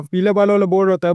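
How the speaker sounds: plain reading voice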